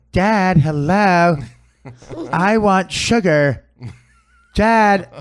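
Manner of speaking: loud, drawn-out mock calls to Dad; put-on demanding son, stretching out the words; hammed-up son hollering slowly for his father; son imitation. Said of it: "that's how he talks".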